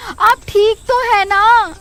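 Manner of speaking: wife asks her husband if he is hurt, worried. Acted. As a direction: fuss over him with concern.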